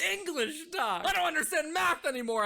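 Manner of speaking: high, loud frustrated yell; [name] and Doc yelling about math; exasperated shout. Acted.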